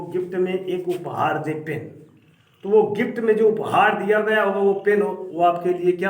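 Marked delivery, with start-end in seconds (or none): none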